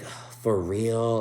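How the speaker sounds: slow, drawn-out mock sighing; exasperated sigh. Imitating Sarah after she hangs up the phone, sighing. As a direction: imitate her sighing in disbelief. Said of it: "she's all sighing".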